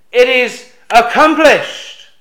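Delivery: slow, loud and high declaration; triumphant cry. Acted. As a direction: proclaim it like a victory shout. Climax of the cry translated.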